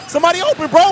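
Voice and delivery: high, fast, urgent yell; frantic fan shout; calling out. Real, not acted. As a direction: yell at the players on the screen. Calling out to players on screen.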